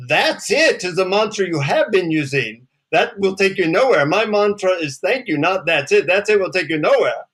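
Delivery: put-on high, scolding Indian-accented guru. Guru correcting the disappointed seeker, scolding Indian accent. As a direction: lecture the pupil in an Indian accent. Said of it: "in his deep Indian accent".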